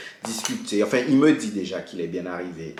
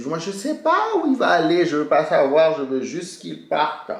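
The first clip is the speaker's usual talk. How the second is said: exasperated retold outburst; 6 semitones higher than usual. Angry retold reply to friends.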